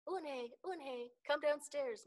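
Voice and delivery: high, loud mother's call; drawn-out, high-pitched call; calling upstairs. A narrator's reading. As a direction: call your child down from upstairs. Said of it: "Mother called up to Unhei".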